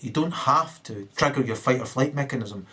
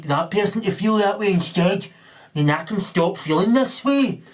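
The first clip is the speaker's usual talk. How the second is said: mock bampot voice; 5 semitones higher, 3 dB louder than usual. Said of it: "coming up to you going".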